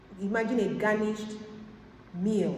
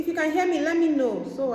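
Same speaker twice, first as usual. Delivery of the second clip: raised, higher voice over a bad line; calling out; 7 semitones higher, 6 dB louder, faster than usual. Real, not acted. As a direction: call loud and high so they hear. Calling out to a caller who can't hear her.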